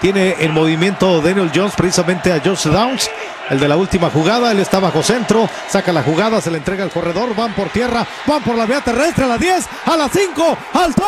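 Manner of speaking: building from loud call to high shout; excited play-by-play. Real, not acted. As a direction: climb with the run to the end zone. Narra en vivo la jugada. (narración que crece hasta el grito)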